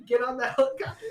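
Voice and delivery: high mock shout through laughter; mock furious yell. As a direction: laughingly repeat a yelled order. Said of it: "He's yelling furiously".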